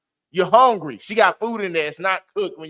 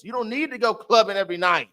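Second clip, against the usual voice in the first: ordinary delivery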